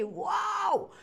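sudden loud bark of a shout; shouted woof. Shown as a demonstration. Teacher shouts woof to show barking at someone. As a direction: yell it out like a barking dog. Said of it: "c'est hurler".

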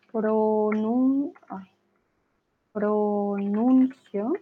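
"pronuncio" very slow, stretching each syllable with a clear U; drawn-out U demo. Shown as a demonstration. Teacher showing a student the U in "pronuncio", slowly stretched. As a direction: draw out "pronuncio" syllable by syllable, sounding the U. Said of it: "pronuncio con U".

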